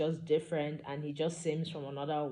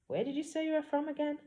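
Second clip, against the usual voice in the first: voiced heroine; 11 semitones higher, faster than usual. Heroine questions the stranger.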